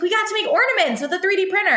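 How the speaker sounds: put-on a child rushing to tell you; little girl. Little girl excitedly explaining.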